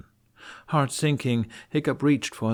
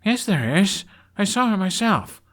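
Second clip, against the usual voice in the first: much higher, louder insistent protest; insistent Hiccup; 12 semitones higher, 7 dB louder than usual. Acted. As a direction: stand your ground against your father. Son insisting to father.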